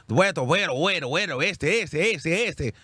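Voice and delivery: playful put-on Spanish filler, bueno, bueno, este, este; mock Spanish accent chatter. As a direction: rattle off Spanish fillers like a parody. Mocking castmate's Spanish chatter.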